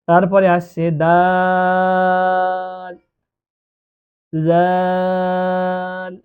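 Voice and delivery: দাল and জাল each held very long and slow; long dal and jal. Shown as a demonstration. Teacher showing the class দাল and জাল with long drawn vowels. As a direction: draw out দাল and জাল slowly. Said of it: "তিন আলিফ পরিমাণ টান দিয়ে পড়তে হবে".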